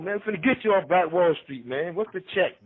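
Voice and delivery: normal talking voice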